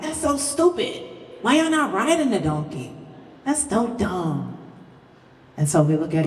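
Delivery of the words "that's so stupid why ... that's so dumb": high, slow scornful jeer; slow, high mocking jeer; mocking townspeople. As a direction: sneer at them like a scornful villager.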